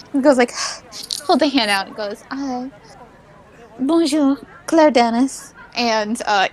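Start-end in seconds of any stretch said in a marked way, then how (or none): 2.3-5.5 put-on slight French accent. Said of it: "is slightly more French".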